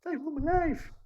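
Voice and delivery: very high, loud sung-out cry; R. Kelly impression. Mocking himself as R. Kelly, high loud wail. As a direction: belt it like R. Kelly. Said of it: "I feel like R. Kelly".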